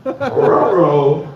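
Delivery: a hint of Scooby-Doo's ruh-roh. Joking about the huge sandwich, light Scooby voice. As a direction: say ruh-roh like a cartoon dog. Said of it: "a Scooby-ass fucking sandwich".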